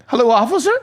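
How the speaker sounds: high-pitched mock-nervous greeting; panicked, anxious. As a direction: blurt it high, imitating pure panic. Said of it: "out of pure panic and anxiety".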